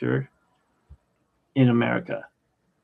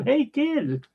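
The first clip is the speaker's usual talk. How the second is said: quoted celebrity greeting; 13 semitones higher than usual. Retold or imitated. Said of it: "He said, he said".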